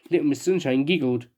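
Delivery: ordinary delivery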